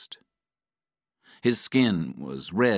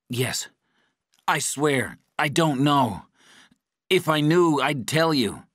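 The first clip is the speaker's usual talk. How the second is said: high-pitched, panicked pleading; desperate pleading; 9 semitones higher, 4 dB louder than usual. A narrator's reading. Patrick swears to his torturer he knows nothing, desperate pleading. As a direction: plead for your life, pitch climbing.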